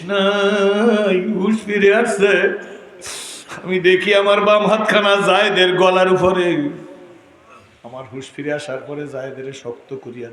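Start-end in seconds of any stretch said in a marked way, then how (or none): 0.0-6.5 higher, louder sermon storytelling; raised preaching. Acted. Preacher telling how the Prophet came round, raised preaching voice. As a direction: lift the voice through the tale.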